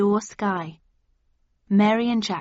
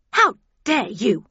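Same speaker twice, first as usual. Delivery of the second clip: loud, affronted shriek with swooping pitch; furious, stagy screech; outraged scream; 2 semitones higher, 6 dB louder than usual. A narrator's reading. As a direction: scream it in indignation, word by word. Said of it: "she screamed".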